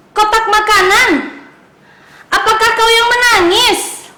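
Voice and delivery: high, eager little pencil asking questions; kids' story pencil calling out high; curious pencil voice. Acted. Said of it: "Katanya seperti itu".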